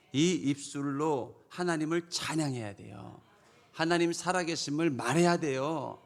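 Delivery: normal talking voice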